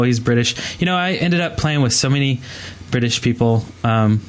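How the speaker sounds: ordinary talk, nothing marked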